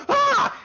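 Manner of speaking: high, loud playful shriek; startled kid scream. Acted. Kids startled by waking lizard.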